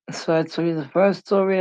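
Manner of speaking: normal talking voice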